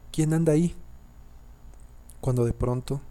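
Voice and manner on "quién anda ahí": higher-pitched, mildly tearful question. A narrator's reading. Frightened grandmother asks who is at the door, mildly tearful. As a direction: call out with a touch of tears. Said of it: "llorando, preguntó".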